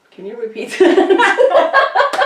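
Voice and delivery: slow, high, laughing ask; confused laughing plea. Retold or imitated. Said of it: "and I'm going".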